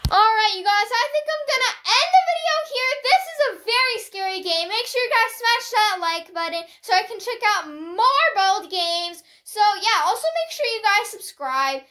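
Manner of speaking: high, loud, energetic YouTuber sign-off; video outro. Real, not acted. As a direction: project an upbeat goodbye to the viewers.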